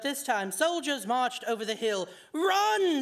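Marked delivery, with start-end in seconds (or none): 2.3-3.0 loud, high cry; a panicked yell of Run; scream. A narrator's reading. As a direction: scream the warning. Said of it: "the Hatter screamed".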